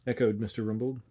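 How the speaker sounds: ordinary delivery, nothing marked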